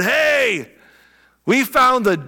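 high-pitched, breaking news to a friend; hyper, excited. Acted. Philip runs up to Nathanael under the tree, hyper and excited. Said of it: "runs up all hyper and excited".

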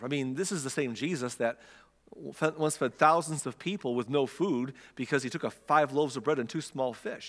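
usual speaking voice

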